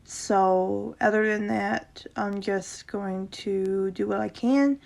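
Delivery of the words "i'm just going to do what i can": ordinary delivery, nothing marked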